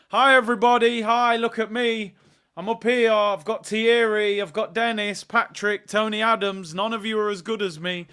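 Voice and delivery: loud smug gloating from the top; boastful. Pretend. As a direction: show off like you're untouchable.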